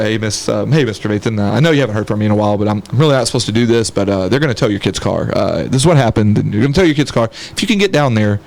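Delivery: low, monotone phone call to a friend's parent; buddy on the phone. Acted. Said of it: "like, on the phone".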